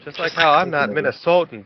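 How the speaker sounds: ordinary delivery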